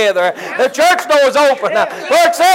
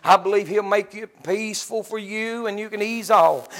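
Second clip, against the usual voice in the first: low, much softer comforting preaching; soft consoling sermon; 5 semitones lower, 5 dB quieter than usual. Acted. Preacher comforting the congregation about death, low and soft. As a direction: ease the mourners down gently, low and soft.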